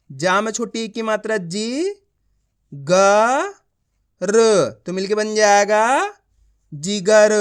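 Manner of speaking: जी, ग, र sounded separately with long gaps, then जिगर as one word; measured drill, lifting जाएगा before a firm जिगर; letter-by-letter reading. Shown as a demonstration. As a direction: model the blending of जिगर for a class. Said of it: "एक एक अक्षर का उच्चारण कर रहा हूँ".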